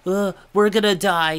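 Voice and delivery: loud, high angry outburst; tense muttering then exploding. Retold or imitated. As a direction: blurt it out angrily. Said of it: "he muttered angrily".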